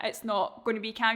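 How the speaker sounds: ordinary delivery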